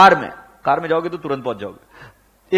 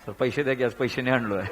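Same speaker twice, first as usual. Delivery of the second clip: timid Marathi-speaking peon; 4 semitones lower, 7 dB quieter than usual. Retold or imitated. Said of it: "तुम्हें भाषा समझ में आई?".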